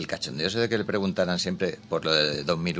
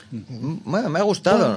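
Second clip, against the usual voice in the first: lukewarm; 8 semitones higher than usual. Retold or imitated. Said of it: "si te digo".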